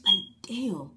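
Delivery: loud, fed-up exclamation; sudden loud annoyed sigh of a word; exasperated outburst. Retold or imitated. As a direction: let the frustration burst out. Frustrated by customers' changes.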